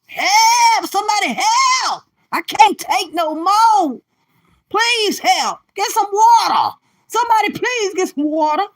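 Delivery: high, loud hammed-up cries for help; comic pleading scream for water; mock liver screaming. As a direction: play a desperate liver begging for rescue. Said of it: "Your liver's over there screaming".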